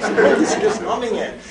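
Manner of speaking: ordinary delivery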